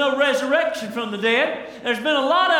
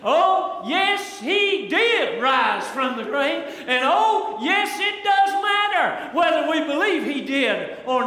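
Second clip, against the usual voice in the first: high, ringing preacher's cry, stretched out slow; soaring, drawn-out shout of conviction; great authority; 4 semitones higher, slower than usual. Acted. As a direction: proclaim it with Paul's full authority. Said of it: "Paul is standing up with great authority".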